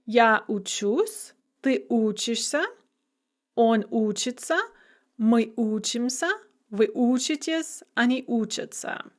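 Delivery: end-stressed учусь, then first-syllable stress on учишься through учатся; shifting stress. Shown as a demonstration. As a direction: recite each form, stressing учусь last and учишься, учится, учимся, учитесь, учатся first. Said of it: "and shifting stress".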